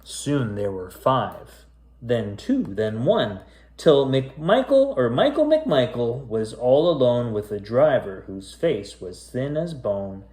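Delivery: spooky rhyming storybook read; story narration. A narrator's reading. Reading a creepy rhyming tale to kids, storyteller voice. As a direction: read it aloud like a bedtime ghost tale. Reads a spooky rhyme aloud.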